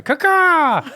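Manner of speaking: high, loud crow cry; bird caw imitation. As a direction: squawk like a crow right in their face. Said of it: "you're up in my face and you're going".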